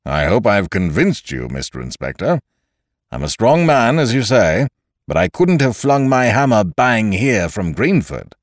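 loud, forceful blacksmith. A narrator's reading. Blacksmith protesting his innocence to the inspector, loudly. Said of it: "the blacksmith say in a loud voice".

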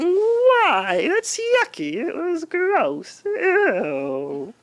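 very high, slow whiny nerd; squeaky grossed-out nerd whine; nerdy voice. Retold or imitated. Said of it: "really nerdy voice".

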